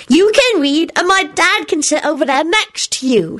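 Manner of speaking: put-on high, bossy little boy; stern child. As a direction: order them about like a little boss. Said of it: "he instructed her sternly".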